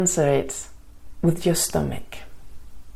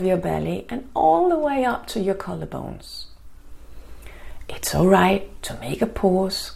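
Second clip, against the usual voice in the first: guided meditation. Acted. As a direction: ease them into calm, gently.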